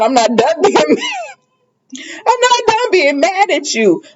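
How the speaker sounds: high-pitched quote through real laughter; laughing mock protest. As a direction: imitate his protest while cracking up.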